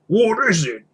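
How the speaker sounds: a curious troll calling out. Acted. Troll asks.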